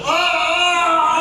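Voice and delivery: high loud cast yell; retold shout. Cast members yelling at a heckler, loud and high. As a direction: blast the heckler with a sudden yell. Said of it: "They'll just go".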